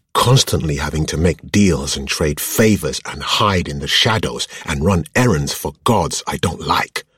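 high, exasperated venting that starts on a loud peak; upset outburst. A narrator's reading. Woman listing what she hates about her life, exasperated.

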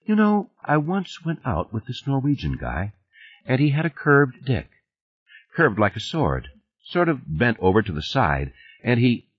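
thoughtful, lighter character voice; thoughtful woman. Acted. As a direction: voice her idly reminiscing. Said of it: "in this thoughtful way she said".

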